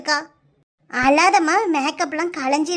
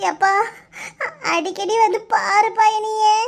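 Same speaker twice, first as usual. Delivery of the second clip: crying; 5 semitones higher than usual. Acted. Bride asks father to visit often, crying. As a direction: draw the words out through sobs. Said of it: "அழாத".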